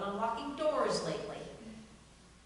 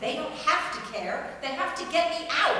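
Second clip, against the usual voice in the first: heated argument; 6 semitones higher, 8 dB louder than usual. Acted.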